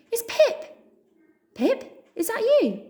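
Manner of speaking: high, squeaky happy calls with a laugh; excited mouse. Acted. Friend spotting Pip in the crowd, squeaky. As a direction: squeal with joy at finding her.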